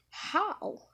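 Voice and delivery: high-pitched, disbelieving question; mock astonishment, voice leaping high; astonished. Zabuza astonished as sword reappears.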